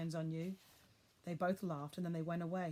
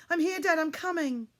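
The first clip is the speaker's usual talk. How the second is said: loud, much higher cry; shouting back; 10 semitones higher, 10 dB louder than usual. A narrator's reading. Boy answering his father while running, shouting. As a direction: shout back as you run.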